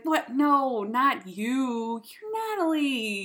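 loud, hammed-up backpedaling; dismissive protest. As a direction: protest it loudly, drawing out the end. Retells backpedaling after awkward remark.

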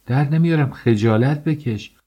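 high, indignant protest; wife refusing to hand over her bracelets; indignant refusal. Acted. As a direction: refuse him with outraged scorn. Wife refuses husband in quarrel.

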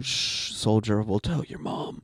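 hushed, soothing low words; calm the dying soldier gently; comforting a dying soldier. Acted. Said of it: "so much role-playing going on".

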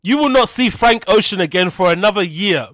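usual speaking voice

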